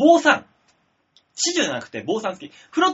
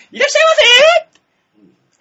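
bright loud shop welcome; 11 dB louder, much faster than usual. Acted. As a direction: sing out the welcome like restaurant staff. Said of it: "ワタミ".

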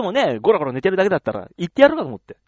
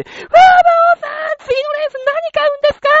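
high-pitched fan lady; 10 dB louder than usual. Retold or imitated. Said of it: "って言った瞬間に". (大興奮のおばさんの甲高い叫び)

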